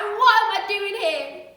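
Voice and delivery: loud, high, slow yelling through the cell door; desperate shout. Acted. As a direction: bang on the door and scream it out. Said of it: "scream and shout".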